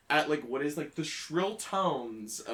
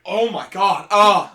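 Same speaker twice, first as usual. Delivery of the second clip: louder panicked yelp ending in a cry; scared cry; 5 semitones higher, 17 dB louder, slower than usual. Retold or imitated. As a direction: flinch and squeal.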